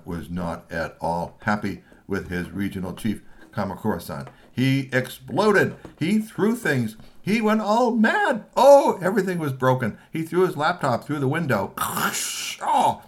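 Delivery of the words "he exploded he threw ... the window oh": high, loud and slow, building; dramatic tantrum narration. A narrator's reading. Narrator describing the boss's explosion, loud and slow. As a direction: tell the rage with big drama.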